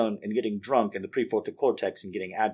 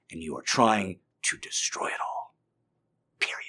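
menacing whisper; 2 semitones lower, 5 dB quieter than usual. Shown as a demonstration. Voicing his triggered mindset.

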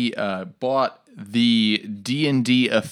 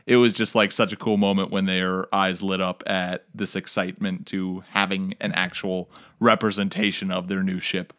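ordinary talk

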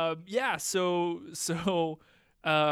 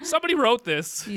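mock indignant; 7 semitones higher, 7 dB louder than usual. Mock outrage at script line.

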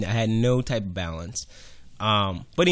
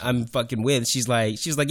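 usual speaking voice

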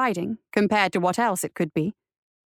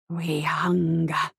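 low, very slow hungry creature; predator voice; 6 semitones lower, much slower than usual. A narrator's reading. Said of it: "the predator said".

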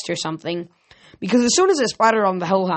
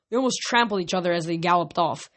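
ordinary delivery, nothing marked

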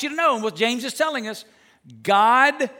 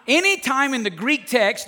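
ordinary delivery

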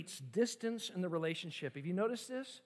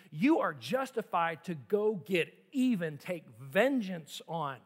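ordinary delivery

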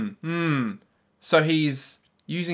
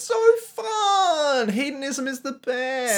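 high, slow whining wail; mock whine; 10 semitones higher, slower than usual. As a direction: wail it like a spoiled hedonist. Mock whining about sins.

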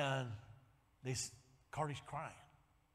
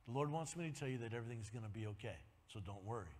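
soft quick quoting of the prophecy; retold prophecy; 8 semitones lower, 8 dB quieter, faster than usual. Preacher retelling Copeland's prophecy, soft and quick. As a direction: pass on the word softly and fast. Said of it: "He goes".

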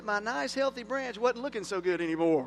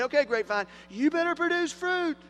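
mock command; 8 semitones higher, 3 dB louder than usual. Mock ordering a grapevine.

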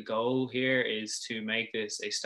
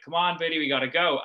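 manager calling out; 5 semitones higher, 5 dB louder than usual. Retold or imitated. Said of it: "their manager screaming out".

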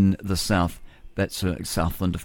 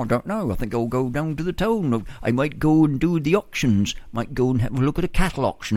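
put-on old-fashioned farmer talk; 4 semitones higher than usual. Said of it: "You could talk like that".

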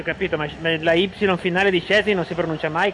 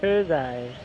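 softer, lower English-accented reading; English-style pronunciation; 7 semitones lower, 6 dB quieter than usual. Shown as a demonstration. Repeating the guide's version to himself, English accent. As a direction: try the name quietly in an English accent. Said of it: "una cosa proprio inglesofila".

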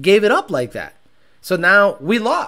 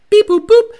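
beeping noises; 13 semitones higher, 8 dB louder than usual. Pretend. Imitating ordering sounds.

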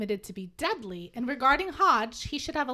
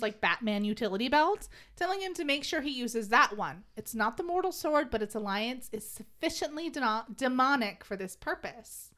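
usual speaking voice

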